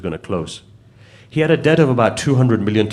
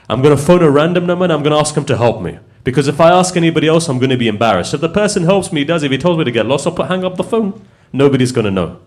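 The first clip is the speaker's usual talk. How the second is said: high, raised voice of a retold desperate plan; agitated resolve; 6 semitones higher, 5 dB louder than usual. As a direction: talk yourself urgently into a risky plan. Retold desperate man resolving to phone.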